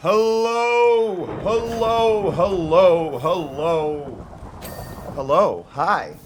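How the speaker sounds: big, loud, drawn-out hellos; booming welcome; calling out greetings. Real, not acted. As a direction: belt out each greeting to the crowd. Calling greetings to arriving viewers.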